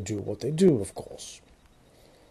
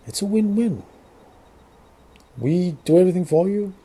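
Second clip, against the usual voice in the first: mock cheerful; 6 semitones higher than usual. Cheery sinister sales pitch.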